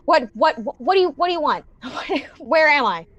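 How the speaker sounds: high, loud, stammering alarm; panicked. Acted. Man backed against the cube hearing voices, panicked. Startled character answers the voices.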